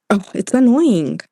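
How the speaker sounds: normal talking voice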